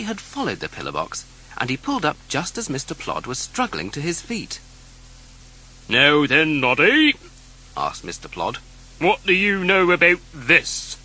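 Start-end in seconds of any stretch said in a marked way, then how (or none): 5.9-11.0 loud, slow, stern police voice; questioning a suspect; stern policeman. Acted. Mister Plod questions Noddy, loud and stern.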